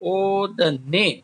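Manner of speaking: ओदने stretched slowly, rising; teacher dictating the dual; slow clear recital. Shown as a demonstration. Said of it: "यहाँ पर होगा".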